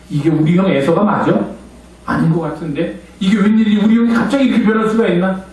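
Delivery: ordinary talk, nothing marked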